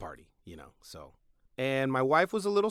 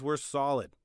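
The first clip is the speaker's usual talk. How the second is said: ordinary delivery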